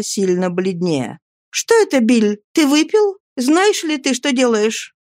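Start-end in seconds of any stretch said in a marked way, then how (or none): 1.5-4.9 high, agitated female voice; high-pitched, urgent woman's voice; alarmed Nancy. A narrator's reading. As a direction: question him in rising alarm. Nancy, paling, confronts Sikes.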